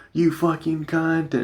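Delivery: high, slow, sneering insult; drunk nasty insult. Retold or imitated. Drunk blackout self insulting friend, high slow sneer. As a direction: spit it out like a mean drunk.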